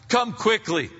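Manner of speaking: a high, loud outcry; loud plea. Pretend. The animal kingdom crying out loudly to God. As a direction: cry it out to the heavens.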